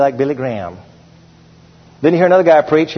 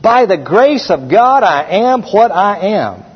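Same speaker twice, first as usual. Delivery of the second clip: slower, higher repeat of By the grace of God; slow emphatic repeat; 5 semitones higher, slower than usual. Shown as a demonstration. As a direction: lean on "By" and stretch "I am what I am" slowly. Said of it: "Please mark that".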